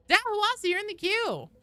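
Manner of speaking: high, loud call-out. Real, not acted. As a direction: call the player up loudly. Calling out to queued player.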